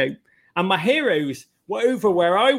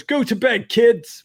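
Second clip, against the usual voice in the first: raised-pitch mock shout; imitated gruff dismissal; 7 semitones higher, 4 dB louder, slower than usual. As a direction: shoo the youngsters off loudly. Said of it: "Uh, he goes".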